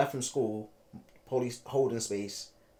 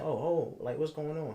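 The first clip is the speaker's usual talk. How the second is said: higher-pitched, mildly startled reaction; retold shock; 6 semitones higher than usual. Student finding police outside class, retold shock. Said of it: "it was shock".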